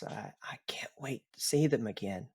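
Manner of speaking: a dying son whispering to his father; weak whisper. Acted. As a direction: let the last breath carry the words. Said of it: "He takes a very long, deep breath".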